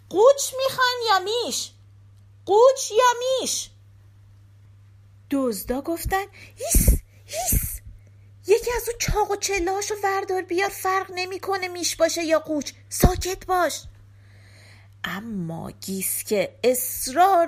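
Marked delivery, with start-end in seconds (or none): 0.1-3.7 high, loud yell; shouting. A narrator's reading. Giske calls out to the thieves from inside the house, shouting. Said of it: "فریاد زد". 6.6-13.8 high, tense shushing; urgent shushing. Acted. Thieves hushing noisy Giske.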